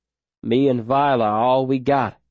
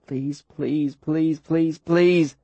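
voice cracking; 3 semitones higher, much slower than usual. A narrator's reading. Boy with welling eyes over the bleeding girl, cracking plea. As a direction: beg helplessly, growing stronger. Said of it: "my voice cracking".

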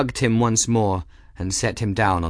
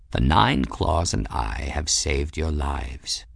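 low, formal, lofty cat voice; proud warrior cat; 6 semitones lower than usual. A narrator's reading. Cat addresses the captain about his mission, lofty. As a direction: declare it deep and self-important.

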